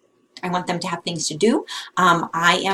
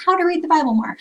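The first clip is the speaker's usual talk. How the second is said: mock search query voice; 10 semitones higher, 4 dB louder, faster than usual. Mimics a kid's web search.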